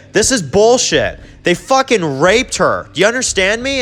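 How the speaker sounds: mock-angry, high-pitched confrontation; indignant outburst. Carl arguing with Daryl, retold.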